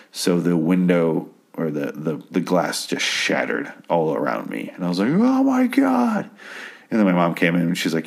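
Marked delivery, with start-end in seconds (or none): 5.1-6.3 high, drawn-out squeal of alarm; exaggerated high-pitched horror; mock panicked cry. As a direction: squeal it slowly, hamming up the shock. Retells panic at shattering glass.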